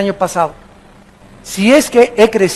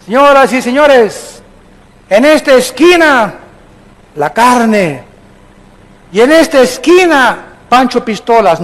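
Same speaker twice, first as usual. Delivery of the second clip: lucha libre announcer; 2 semitones higher than usual. Pretend. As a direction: announce the fighters like a ring MC. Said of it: "salen dos boxeadores". (presentador de lucha libre)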